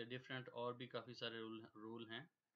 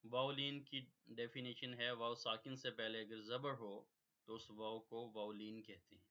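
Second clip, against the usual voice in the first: usual speaking voice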